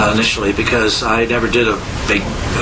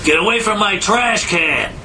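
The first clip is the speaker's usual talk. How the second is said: higher, slow, grumpy growl of a grouch; Oscar the Grouch; 11 semitones higher than usual. Acted. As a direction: snarl it like a grumpy trash-can dweller. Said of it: "Oscar will come up".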